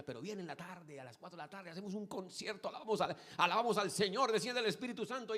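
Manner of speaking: high excited pitch building to loud fervor; imitated rising enthusiasm. Friend boasting of the afternoon concert, imitated mounting enthusiasm.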